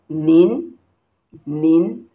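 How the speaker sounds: "nin" sweeping upward in pitch; rising tone on nin. Shown as a demonstration. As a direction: raise your voice through "nin". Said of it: "like you are raising your voice".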